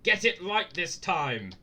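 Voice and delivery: loud, slow, booming shout. Acted. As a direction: bellow it out slowly. Reading the wall writing.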